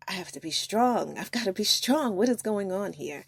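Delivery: retold panicked self-talk.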